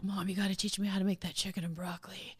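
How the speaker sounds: Jaden. Retold or imitated. Son asking mom to teach him a recipe, hushed imitation. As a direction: quote your child in a hushed, understated voice. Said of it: "Jaden is just always like".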